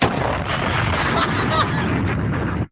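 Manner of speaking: long, wheezy high laugh; breathless laughing fit; cracking up. Real, not acted. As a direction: lose it completely laughing. Cracks up at drug accusation.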